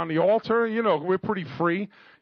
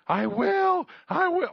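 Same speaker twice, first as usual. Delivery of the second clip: high-pitched re-enacted scream; frantic screaming; 14 semitones higher, 3 dB louder, slower than usual. Preacher acting out his sudden change of answer, screaming. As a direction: cry it out far up high. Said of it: "getting a schizo makeover".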